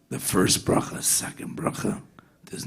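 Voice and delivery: hushed whisper, building the tension; whispered suspense. A narrator's reading. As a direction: whisper it as if the whole hall is holding its breath. Suspense at the wedding ceremony.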